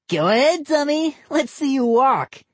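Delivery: put-on high taunting boy; taunting kid voice. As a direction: dare the dummy to walk. Boy character voice.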